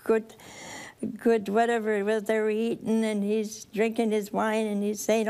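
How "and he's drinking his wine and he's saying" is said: ordinary talk, nothing marked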